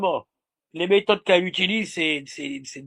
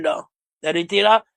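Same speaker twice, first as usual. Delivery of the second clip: normal talking voice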